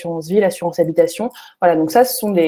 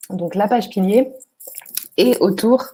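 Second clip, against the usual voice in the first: hoarse, fading voice; losing voice; 2 semitones higher than usual. Real, not acted. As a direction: voice giving out. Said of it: "ah, j'ai plus de voix".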